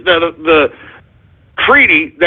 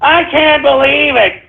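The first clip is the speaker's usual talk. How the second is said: higher, whiny mock outrage of a McCain defender; higher, sneering imitation of a shocked admirer; mock outraged fan; 5 semitones higher than usual. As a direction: parody the scandalized believer. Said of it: "you're like".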